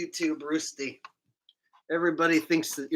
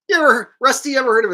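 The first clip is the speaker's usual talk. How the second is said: excited; 10 semitones higher, 9 dB louder, much faster than usual. Real, not acted. Excited talk to the dog.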